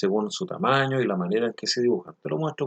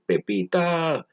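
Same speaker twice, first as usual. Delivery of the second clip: high, drawn-out calling cry; mock shout; 7 semitones higher, much slower than usual. Teacher voicing a comic character calling out for Pepita, mock shout. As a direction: call the name out high and long, without full volume. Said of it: "el personaje está gritando".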